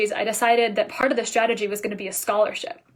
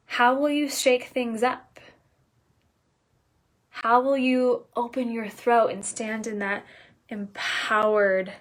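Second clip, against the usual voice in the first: ordinary delivery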